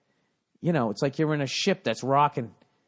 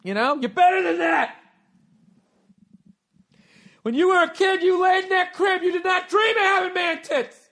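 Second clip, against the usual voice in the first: high, loud coach yell; hammed-up pep-talk shouting; motivational shout; 3 dB louder than usual. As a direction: fire him up like a drill coach. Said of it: "I know how to do it".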